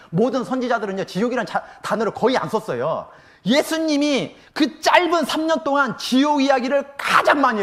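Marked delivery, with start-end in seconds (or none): 3.4-7.6 preaching emphasis. Acted.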